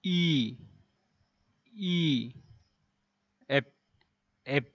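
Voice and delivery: ই and এফ said apart, as a teacher models them; letter names said slowly. Shown as a demonstration. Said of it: "এই এফ যখন উচ্চারণ করবেন". (শিক্ষকের মতো ই, এফ উচ্চারণ)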